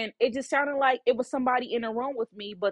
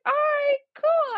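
much higher, eager yes; high excited agreement; 5 dB louder, slower than usual. Retold or imitated. She answers the proposal in a high, eager voice. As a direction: squeak out a happy okay.

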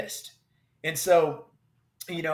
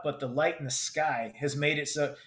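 ordinary talk, nothing marked

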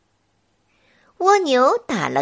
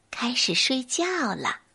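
ordinary delivery

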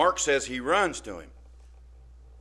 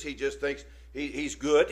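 usual speaking voice

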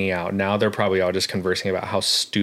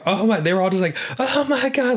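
high squealing mock Oh my God, much higher; imitating the attendants; 12 semitones higher than usual. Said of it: "She was like".

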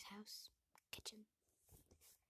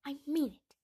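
loud, higher-pitched outburst; exasperated, forceful insistence; firm insistence; 6 semitones higher, 16 dB louder than usual. A narrator's reading. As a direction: cut through the joking, loudly. Hermione insists after Ron jokes.

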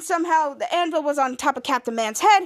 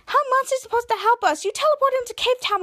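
raised, incredulous challenge; high-pitched objection; 9 semitones higher than usual. Acted. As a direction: push back in a much higher voice. Acted objection, worried challenge.

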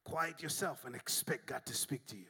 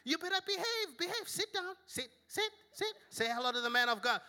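high sweet lady voice; imitating the lady; 14 semitones higher than usual. Dog owner introducing pastor to the family, high sweet. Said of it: "the lady's like".

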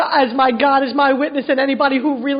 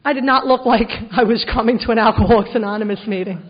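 ordinary talk

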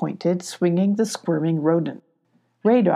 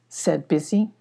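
plain reading voice

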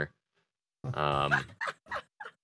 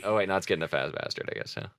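ordinary delivery

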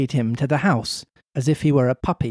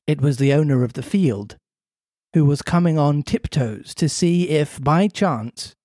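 ordinary talk

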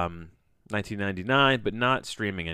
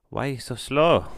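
put-on higher, louder broken English in a vague foreign accent; 4 semitones higher, 5 dB louder than usual. Host complaining about slow internet, mock foreign accent.

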